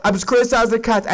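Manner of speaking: fast, rattled-off insistence; fast repeat. Real, not acted. Defending himself to SNL, fast rattled-off repeat. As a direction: rush it out like an excuse. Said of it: "repeated quickly".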